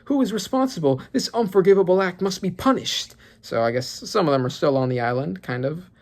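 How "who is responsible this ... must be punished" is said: lightly voiced haughty noble, pitched higher; a hint of a pompous aristocrat; Celestial Dragon. Reading the Celestial Dragon's lines, mildly haughty higher voice. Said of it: "Celestial Dragon here saying".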